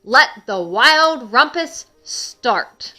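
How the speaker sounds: high, loud, slow proclamation; crying out. A narrator's reading. King Max opens the wild rumpus, crying out. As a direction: launch the party with a drawn-out shout. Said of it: "cried Max".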